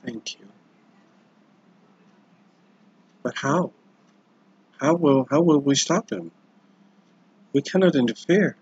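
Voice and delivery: a hint of a fearful young lady, pleading; lady's worried voice. Lady asks the Lord how they will stop him, a hint of worry.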